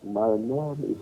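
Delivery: softly into a phone; too quiet on the phone. Real, not acted. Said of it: "Please speak up, I can't hear you".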